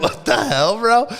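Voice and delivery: high, incredulous what the hell; stunned disbelief. Retold or imitated. To the manager who springs the task, high disbelief. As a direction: squeak out your disbelief at the news. Retold shock at being sent alone.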